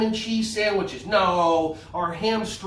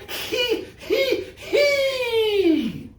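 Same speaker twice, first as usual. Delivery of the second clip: very high squealed hee-hee-hee; "hee" giggle; 3 dB louder, much slower than usual. Shown as a demonstration. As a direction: squeal out a demonstrated giggle, last one long. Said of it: "Everyone go".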